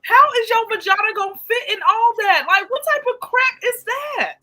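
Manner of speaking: high, shocked disbelief; can't believe what you see; high incredulous outburst. Retold or imitated. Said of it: "I said".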